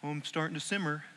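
quiet, quick mock calm; a soft, hurried hint of calm irritation; mock slow simmer. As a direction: pretend the anger is only simmering. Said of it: "when I get angry, it's not just like".

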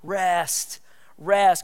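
REST set apart as its own sentence, falling; emphasis on rest. Real, not acted. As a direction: land on REST alone, then hit it harder. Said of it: "I really wanna emphasize this word".

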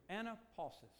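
anapausis said as a-na-PAU-sis, Greek style; Greek word. Shown as a demonstration. Preacher teaching the Greek word, careful pronunciation. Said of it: "I'm gonna say it in the Greek".

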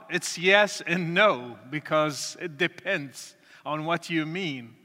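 ordinary talk, nothing marked

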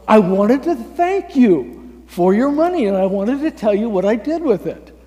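loud, high, eager call; excited thanks. Retold or imitated. Street man thanking the giver who helped him, loud and eager. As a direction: burst out thanking, starting big and easing off.

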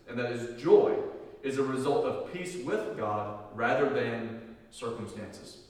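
normal talking voice

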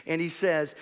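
ordinary talk, nothing marked

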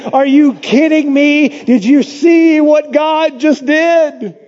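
high, loud, slowed delight; overjoyed. Retold or imitated. Elijah celebrating God's fire, excited high shout. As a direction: burst with joy at the victory. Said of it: "He is so happy".

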